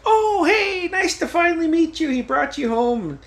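very high, sing-song mock mom greeting; gushing mom. Mom meeting her son's girlfriend, gushing.

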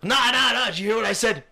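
mock pushy customer. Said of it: "He's like".

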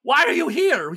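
Yevgeny's voice. Acted. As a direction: play the slick contact urging calm. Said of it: "GM voicing Yevgeny".

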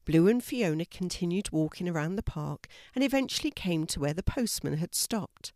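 plain reading voice

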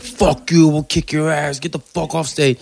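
high-pitched mock angry yell; angry heckler. Angry audience members heckling a comic off stage, mock outrage. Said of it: "and they were mad".

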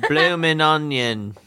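put-on Australian accent. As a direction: stretch it out in a comic Australian accent. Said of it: "Can you guys do it?".

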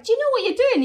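high, loud Irish voice; excited Irish accent. Retold or imitated. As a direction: call it out in an Irish lilt. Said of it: "Can I make her Irish?".

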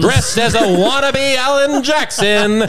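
high, slow, projected announcement; ring announcer. Pretend. Host teases the guest's outfit, mock ring-announcer voice. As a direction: draw it out like a fight intro. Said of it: "Bruce Buffer better watch out".

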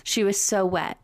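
usual speaking voice

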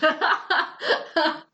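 laughing through the words; giggling while telling it; amused, laughing. Real, not acted. Laughing at the heroine's panic.

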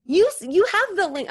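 high, loud exasperated comeback; bickering retort. Retold or imitated. Friend answering the nagging about the link, exasperated retort. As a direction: snap back like a squabbling sibling. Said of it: "siblings who fight all the time".

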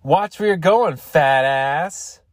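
high, loud jeering call, rising on fat ass; mock taunt. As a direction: sing out a schoolyard insult. Retold taunt from the fat girl.

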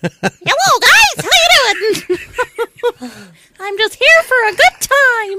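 very high, squeaky cartoon critter; high-pitched quokka voice. Friend asked how quokka would sound, high-pitched cartoon voice. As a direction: squeak it out like a chirpy Mickey Mouse. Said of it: "do you have a high-pitched voice".

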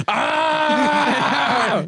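sudden scary shout. Shown as a demonstration. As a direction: yell to scare a lion off. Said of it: "[name], do yours".

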